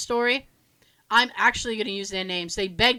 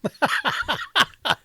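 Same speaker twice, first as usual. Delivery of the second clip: hearty laugh; 3 semitones higher, 3 dB louder than usual. Real, not acted. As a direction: crack up at the joke. Laughing at the retold joke.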